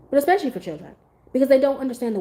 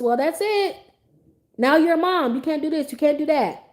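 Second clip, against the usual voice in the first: higher, imitated naysayer; mock scolding; 5 semitones higher than usual. People telling a new mom her life is over, mock scolding. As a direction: lay down rules in a mock scold. Voicing people who limit moms.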